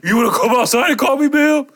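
high, loud mock tough guy; retelling the GameStop worker squaring up; imitated challenge. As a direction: puff up and dare him to step outside. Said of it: "He said".

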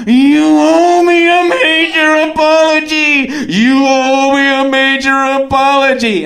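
mock yell with every vowel stretched out high; long-breath bellow. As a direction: hold each word like an over-trained singer yelling. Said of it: "You're getting out so much in one breath".

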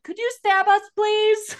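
very high, squeaky mock kid voice; imitated children. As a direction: plead like little kids, hammed-up. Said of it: "looks at the kids and they're like".

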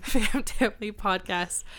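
slow retake through a laugh; careful retake of Fam Family Podcast, still laughing. Real, not acted. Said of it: "keep going".

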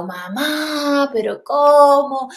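high, drawn-out mock reproach; retold dismay. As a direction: stretch the question in scandalised disbelief. (reproche incrédulo, alargado y agudo)

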